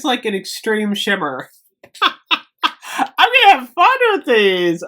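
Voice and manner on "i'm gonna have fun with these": high, loud burst of delight; real excitement, pitched well up and louder; gleeful. Real, not acted. Vlogger delighted by new shimmery makeup, gleeful.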